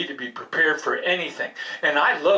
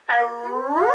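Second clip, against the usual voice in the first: long rising howl; dog howl; 5 semitones higher than usual. Acted. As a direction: howl to soothe hurt feelings. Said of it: "howl with me".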